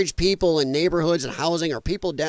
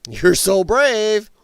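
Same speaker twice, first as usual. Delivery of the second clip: sarcastic; 5 semitones higher, 5 dB louder, much slower than usual. Acted. Sarcastic taunt at protesters.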